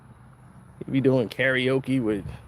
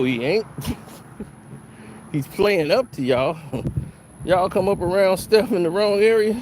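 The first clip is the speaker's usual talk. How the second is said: usual speaking voice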